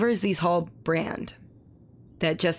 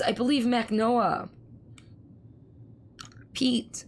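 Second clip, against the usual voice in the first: ordinary delivery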